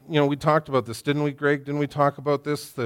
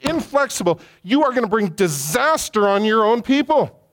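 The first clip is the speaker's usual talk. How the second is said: much higher and louder, climbing rage; furious rebuke; 9 semitones higher, 3 dB louder than usual. Retold or imitated. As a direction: Moses warning Pharaoh of disaster. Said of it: "And Moses says".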